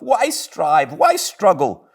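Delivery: very high Toad voice, giving in; yielding to his craving; Toad to himself. Acted.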